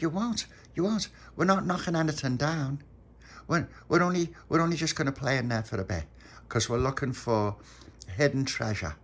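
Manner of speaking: put-on high eager little boy; small boy voice. Pop reassuring Dolores about the bombsite, high boyish voice. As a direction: sound like a keen young lad.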